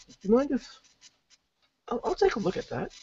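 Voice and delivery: character voice. Acted. Character asks in put-on voice.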